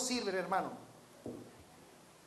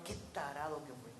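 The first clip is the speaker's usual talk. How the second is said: much lower, softer self-rebuke; self-mocking mutter; 11 semitones lower, 13 dB quieter, faster than usual. Real, not acted. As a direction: scold yourself under your breath. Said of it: "con el perdón de la palabra". (reproche propio en voz baja)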